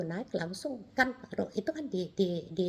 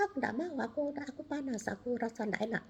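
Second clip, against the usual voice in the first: higher, softer mock child's refusal; retold reluctant refusal; 4 semitones higher, 5 dB quieter than usual. Child declines seeing God's house, reluctant and small-voiced. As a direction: retell a reluctant child, voice raised in pitch and hushed. Child balks at going along.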